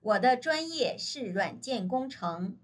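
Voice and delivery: ordinary delivery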